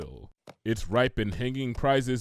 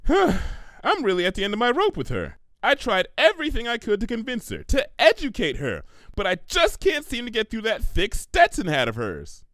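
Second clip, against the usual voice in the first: higher, louder, fed-up rant; exasperated Twilight; 12 semitones higher, 4 dB louder than usual. Acted. Twilight venting to Pinky, exasperated. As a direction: at the end of her rope, venting.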